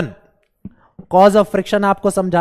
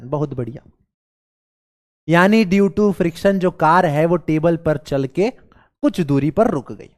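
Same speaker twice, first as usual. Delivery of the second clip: ordinary delivery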